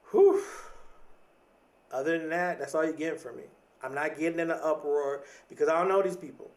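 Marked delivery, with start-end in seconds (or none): 0.1-0.4 loud, high exclaimed whew; sharp whooped exhale of feigned amazement; mock-amazed whew. Speaker reacts to other people's drama, mock-amazed whew.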